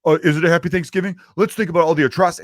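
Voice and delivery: high, loud, put-on contrarian voice; mock a killjoy butting in with a question; pointed, preachy mock objection; challenging commenter.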